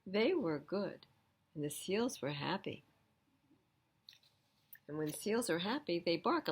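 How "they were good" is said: ordinary delivery, nothing marked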